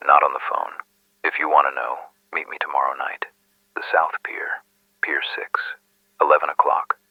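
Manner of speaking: hushed, whispery informant; secretive whisper. Acted. Anonymous caller setting a secret night meeting with the detective, guarded whisper. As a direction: give the instructions under your breath.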